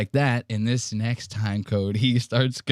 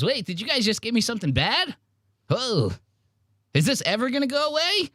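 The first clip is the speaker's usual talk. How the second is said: high, fast, panicky mock voice; freaked-out Mark; 11 semitones higher, faster than usual. Mocking Mark, who fears the pouch won't wear off, high and rushed. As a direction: rush it like he thinks he's poisoned. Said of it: "He goes".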